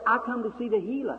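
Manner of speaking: high imitated Southern girl's voice; high Southern-accented plea, imitated; Southern talk. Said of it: "that Southern talk".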